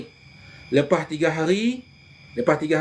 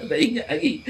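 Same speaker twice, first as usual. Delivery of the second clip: 1 laughing memory; 5 semitones higher than usual. Real, not acted.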